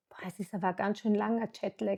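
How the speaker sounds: quiet, quick muttering to herself; murmured thought. Retold or imitated. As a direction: mutter it under your breath, puzzled. Innerer Gedanke, verwundert. (leise, schnell vor sich hin gemurmelt)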